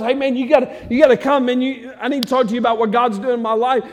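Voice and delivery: high, loud and fast; excited invitation. Retold or imitated. New believer inviting a friend to church, loud and fast. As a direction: burst out with the good news. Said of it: "We're so excited about salvation".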